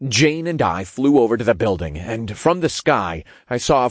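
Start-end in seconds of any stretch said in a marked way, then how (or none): none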